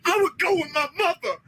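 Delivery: mock wailing. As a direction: wail the word like a mock mourner. Mocking pained wailing cries.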